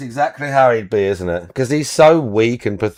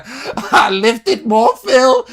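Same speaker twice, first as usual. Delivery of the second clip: very high, very slow mocking girlfriend voice; mocking Kat voice; 12 semitones higher, 4 dB louder, much slower than usual. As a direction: squeak it out slowly, teasing. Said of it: "I can't even do her voice anymore".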